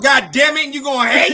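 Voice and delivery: high-pitched loud protest; outraged shout. Real, not acted. Mock outrage.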